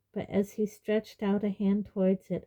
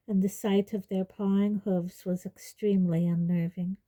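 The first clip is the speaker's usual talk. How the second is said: ordinary delivery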